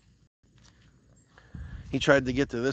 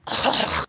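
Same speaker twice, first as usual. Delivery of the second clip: sudden dry cough; coughing after swallowing a bug. Real, not acted. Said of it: "think a bug just flew in my mouth".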